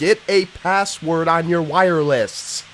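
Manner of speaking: slow, loud, word-by-word comeback; acted retort. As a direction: spell it out slowly for an imagined caller. Acted retort to retold caller.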